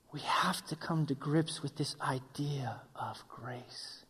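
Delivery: slow, hushed whisper. Acted. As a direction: draw them in with a slow whisper. Drops voice for key point.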